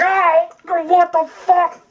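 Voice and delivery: hammed-up shocked brother; mock disgust. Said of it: "his brother's just like".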